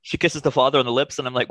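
ordinary talk, nothing marked

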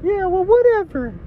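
dismissive, very high falsetto; high-pitched comic girlfriend; Aaron Rodgers' girlfriend. Acted. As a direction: brush it off in a squeaky mock-female voice. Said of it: "my name's Aaron Rodgers' girlfriend".

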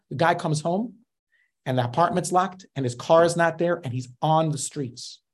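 normal talking voice

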